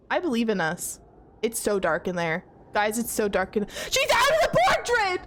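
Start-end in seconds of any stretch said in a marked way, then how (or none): 3.9-5.2 a high, loud panicked yell; panicked shout. Real, not acted. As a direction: scream it out in real fright. Monster appears, player panics.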